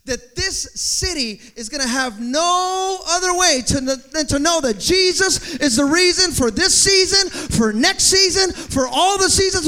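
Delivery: loud, high, driving sermon; full-force preaching. Acted. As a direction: build and hammer every phrase.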